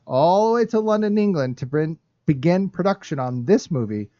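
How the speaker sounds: usual speaking voice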